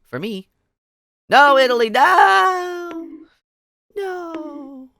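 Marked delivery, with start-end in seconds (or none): 1.3-4.9 high, wailing mock cry of no; Italy no meme cry. Streamer reacting to the game with a wailing meme cry. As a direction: whine it like a crying cartoon.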